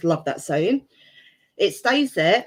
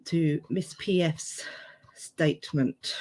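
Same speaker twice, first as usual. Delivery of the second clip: whisper; 3 semitones lower, 7 dB quieter than usual. Real, not acted. Reading name from inquest.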